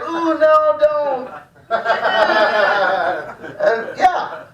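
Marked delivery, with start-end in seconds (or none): 0.0-1.3 a higher, hammed-up squeal of "no, don't"; mock coy protest. The other player dodging the tag, in a high, hammed-up squeal. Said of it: "that other guy's like". 1.7-3.5 laughing at his own joke; real laugh. Real, not acted. Laughing at his own baseball bit, real and open.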